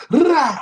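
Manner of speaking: high, loud playful "Rah!"; mock gunshot shout. Joking that the keyboard sounds like a gun, a high loud mock shot. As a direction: bark it like a burst of gunfire.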